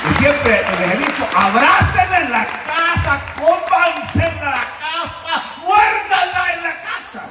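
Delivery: high, slow, loud preaching call; preaching shout. Real, not acted. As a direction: belt it out to the congregation. Preacher shouting advice to congregation.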